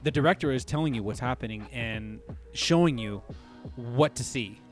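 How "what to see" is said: ordinary talk, nothing marked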